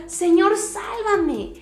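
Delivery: high cry for help, loud on the first word; retold desperate cry. Peter going under cries to the Lord, high shouted plea. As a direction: act out calling to be rescued. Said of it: "pienso que gritó y dijo".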